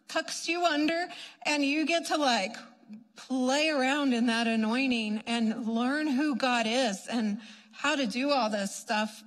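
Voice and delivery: normal talking voice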